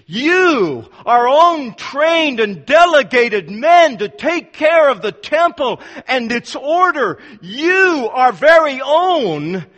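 emphatic berating. Retold or imitated. Pharisees berating their own officers, loud emphatic scolding. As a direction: scold them as betrayed superiors. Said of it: "it's an emphatic you".

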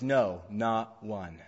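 slow, weighty "No, not one"; slow, deliberate falling "No, not one"; emphatic. Shown as a demonstration. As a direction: drag out "No" and "not one" slowly, each word firm. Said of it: "makes it emphatic".

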